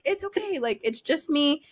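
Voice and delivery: calm him with each word; reassuring. Retold or imitated.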